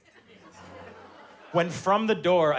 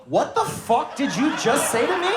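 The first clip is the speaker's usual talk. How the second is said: higher and louder, through a laugh; mock outraged yell; 8 semitones higher, 5 dB louder than usual. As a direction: blow up in fake outrage. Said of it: "And I go".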